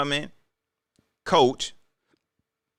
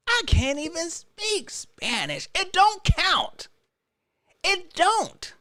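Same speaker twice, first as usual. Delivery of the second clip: playing Bryce; 9 semitones higher, slower than usual. Host voices Bryce denying he speaks Spanish, high and meek. Voicing Bryce Young again.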